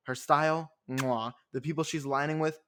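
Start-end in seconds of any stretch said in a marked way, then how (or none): 0.9-1.3 smacked kiss sound of approval; quick verbal kiss of praise; chef's kiss mwah. Real, not acted. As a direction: blow an appreciative kiss, mwah. Chef's kiss praise of her style.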